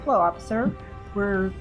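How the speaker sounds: ordinary talk, nothing marked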